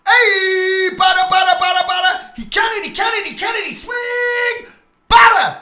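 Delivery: high, loud mock ballpark heckling; baseball heckler. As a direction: jeer like a fan at the plate. Baseball heckling chant, mocking.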